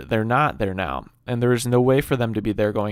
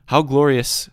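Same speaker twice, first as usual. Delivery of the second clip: mock-sarcastic quote, a bit louder; 2 semitones higher, 6 dB louder, slower than usual. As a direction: voice her scorn with a louder push. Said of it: "she says this sarcastically".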